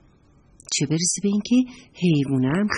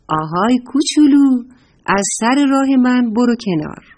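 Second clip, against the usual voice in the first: loud, raised storybook command; calling out; 6 semitones higher, 6 dB louder than usual. A narrator's reading. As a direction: order a little one out of your path. Elephant orders squirrel out of way. (با صدای بلند و آمرانه)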